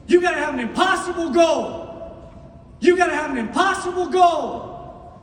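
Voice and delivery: replaying a mentor's line, shouting it; repeated shout. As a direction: shout it like a mantra. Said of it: "Man, play that back".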